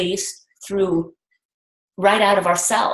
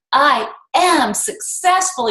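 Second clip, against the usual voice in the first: slow, drawn-out I am successful with the am lifted; believing it; 4 semitones higher, slower than usual. Shown as a demonstration. Coach to team, final heartfelt repeat, slow and convinced. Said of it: "by the time you get to the last one".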